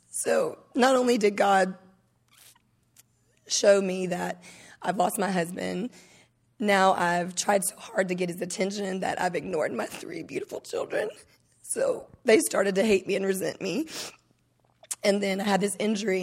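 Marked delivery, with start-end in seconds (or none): none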